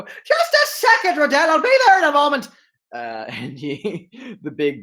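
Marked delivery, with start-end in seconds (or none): 0.0-2.5 loud, high, fast shout from another room; shouting from afar. Acted. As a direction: yell back from below deck. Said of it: "a shouting voice go".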